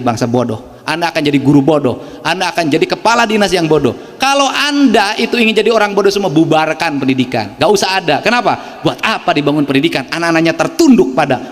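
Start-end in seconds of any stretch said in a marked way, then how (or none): none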